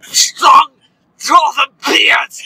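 loud, hammed-up Scottish dwarf voice; Dwarven Scottish accent. Friend boasting about dwarf beards, hammed-up Scottish dwarf. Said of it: "I speak a Dwarven with all the proper phlegm".

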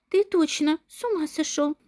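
ordinary delivery

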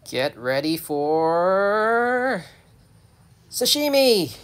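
slow rising wind-up into a high, loud burst; mock battle cry. Rescuer swinging the net at the hedgehog, mock battle cry. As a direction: stretch the build-up, then yell the last word.

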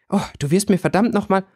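high, exasperated scolding; a character cursing under pressure, pitched high. A narrator's reading. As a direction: snap it out in annoyance. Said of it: "schimpfte Winona".

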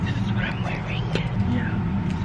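hushed unvoiced whisper. Real, not acted. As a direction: whisper so no one hears. Quiet in changing area.

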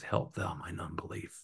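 low, very soft humble plea; quiet, low admission of weak faith; humbled plea. Retold or imitated. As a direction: say it small and hushed, humbling yourself. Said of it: "he humbles himself".

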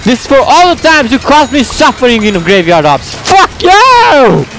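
high-pitched gamer revenge yell; vengeful rant. Pretend. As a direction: spit revenge, rising to a yell. Vengeful attack in game.

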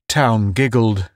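usual speaking voice

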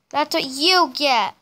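ordinary talk

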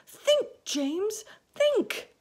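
very high, loud desperate plea; begging. A narrator's reading. Miss Spider begging James. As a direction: beg with each word. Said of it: "begged Miss Spider".

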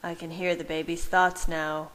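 plain reading voice

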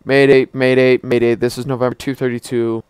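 loud urgent distress call; mayday radio call. Pretend. Plane about to crash.

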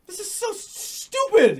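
high, drawn-out exasperated cry. Real, not acted. Complaining to a friend about a silly baby game, exasperated.

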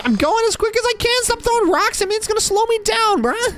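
very high-pitched squealing complaint; frantic whining protest. Acted. Kid told to hurry to school, frantic whining protest.

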